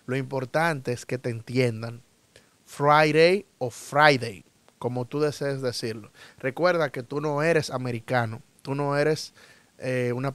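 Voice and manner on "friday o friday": Friday said two ways, tapped "dei" then plain "dei"; two pronunciations. Shown as a demonstration. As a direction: slowly set Friday against its variant Friday. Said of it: "Otras personas dicen Friday y también está válido". (Friday de dos maneras, lento y marcado)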